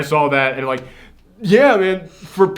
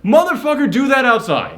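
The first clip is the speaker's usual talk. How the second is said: high-pitched, exasperated retelling of a telling-off; mock angry retort; 9 semitones higher than usual. Fed-up moviegoer to noisy college kid, high mock exasperation. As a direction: act out being fed up, voice jumping up.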